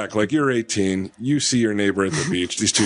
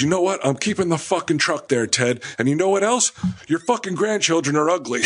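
retold outburst, pitch higher and pushed; mock-furious neighbor comeback, raised pitch; lost his cool; 7 semitones higher than usual. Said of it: "Edgar loses his cool".

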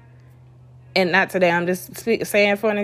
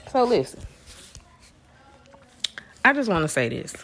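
congested; faster than usual. Real, not acted. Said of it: "excuse me if I sound a little congested".

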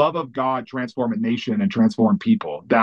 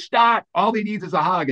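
urgent interjection; 6 semitones higher than usual. Retold or imitated. Heidi stopping men tackling a guy, urgent interjection. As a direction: imitate an urgent plea, pitched high.

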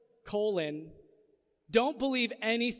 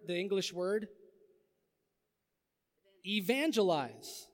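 usual speaking voice

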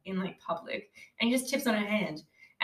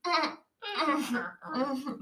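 imitated wordless "mm" whimpers; string of strange hummed grunts; weird character noises; 2 semitones higher, much slower than usual. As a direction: mimic her odd little closed-mouth moans. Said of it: "she makes all those weird noises".

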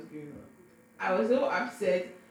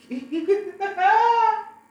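a high, loud burst of laughter; 13 semitones higher, 11 dB louder than usual. Real, not acted. Telling the pool story, she bursts out laughing.

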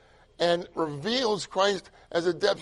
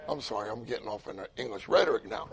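ordinary talk, nothing marked

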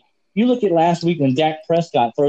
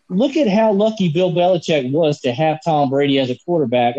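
ordinary talk

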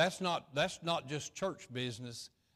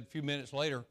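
ordinary delivery

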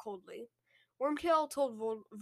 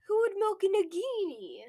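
mock Voldemort voice; 8 semitones higher, 5 dB louder than usual. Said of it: "Voldemort was like".

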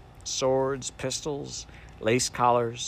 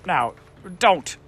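quick, raised and louder hurry to stop her; hasty; 8 semitones higher, 8 dB louder than usual. A narrator's reading. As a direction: rush in to stop the crying. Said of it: "said the Samiad hastily".

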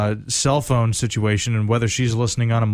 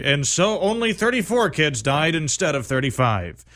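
imitating a TV reporter signing off, slower at the end; smooth on-air news voice, a bit higher; 6 semitones higher than usual. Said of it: "he wrapped up his news story".

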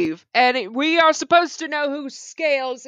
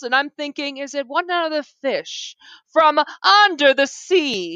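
ordinary talk

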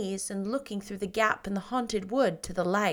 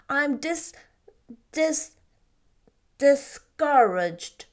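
stumbling, slow, louder on discouraged; struggling with a big word; 5 semitones higher, 6 dB louder, much slower than usual. Acted. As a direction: fight your way to the word discouraged. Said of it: "bringing out the big word with valiant effort".

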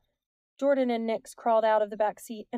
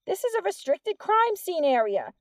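loud, much higher-pitched official voice; shouted warning; 9 semitones higher, 5 dB louder than usual. A narrator's reading. Officer warning kids off a crime scene, shouting. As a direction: shout the rule up at them. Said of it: "the officer shouted".

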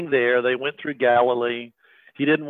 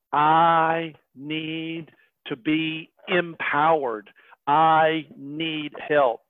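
slow earnest plea; much slower than usual. Acted. Preacher voices his own need.

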